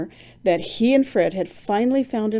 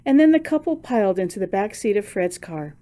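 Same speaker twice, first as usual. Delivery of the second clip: ordinary delivery, nothing marked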